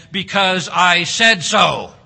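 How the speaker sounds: loud, slow stern parent; mock parent retort. Parent ending a child's question, loud mock retort. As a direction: lay down the law.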